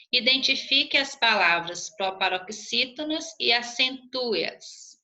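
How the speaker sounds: usual speaking voice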